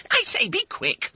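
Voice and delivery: very high schoolboy voice; impatient urging. Acted. Schoolboy waiting for the letter to open, impatient. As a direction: hurry him along, squeakily high.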